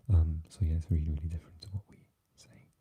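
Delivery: ordinary delivery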